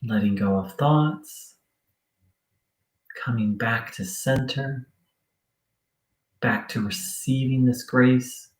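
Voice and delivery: sparse, subdued meditation cues with long silences; guided meditation. Acted. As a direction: ease them back into stillness.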